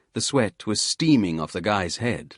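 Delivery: usual speaking voice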